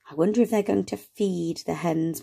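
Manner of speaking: usual speaking voice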